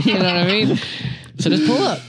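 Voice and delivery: high-pitched, talking through laughter; laughing. Real, not acted. Podcaster cracking up at their own crude joke, laughing. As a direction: crack up while getting the words out.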